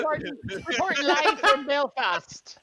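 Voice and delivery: slow, high mock Belfast news voice; hammed-up Belfast accent; mock Belfast reporter. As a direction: play a Belfast reporter going live. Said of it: "the Belfast was good".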